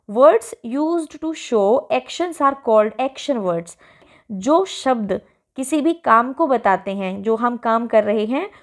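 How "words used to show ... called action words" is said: textbook instruction read out very slowly; slow read-aloud. Acted. Teacher reading the worksheet task aloud to pupils, slow and clear. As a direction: read the task line deliberately, word by word.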